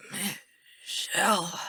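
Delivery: slow, soft dying rasp; weak, breathy croak of a name. A narrator's reading. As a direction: rasp it faintly with failing breath. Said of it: "he rasps".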